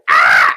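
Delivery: loud shrieking gull cry; seagull screech. Retold or imitated. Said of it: "doing the impressions".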